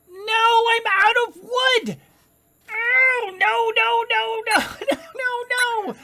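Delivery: high, loud panicked cry; panicked wail. Real, not acted. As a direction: wail in dismay, laughter breaking in. Panics on running out of wood.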